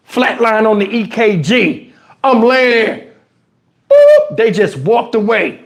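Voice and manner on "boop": high, sharp machine beep; monitor beep. Retold or imitated. Imitating flatline monitor beep.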